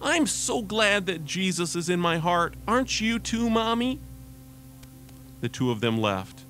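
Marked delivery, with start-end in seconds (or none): 0.0-4.0 put-on high, earnest five-year-old voice; innocent, happy child, much higher; little girl voice. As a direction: share it gladly like a small child. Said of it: "said to her mom".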